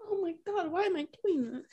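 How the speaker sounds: soft, high-pitched overwhelmed lament; freaking out. Real, not acted. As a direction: despair at yourself in a small voice. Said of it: "I'm freaking out over this".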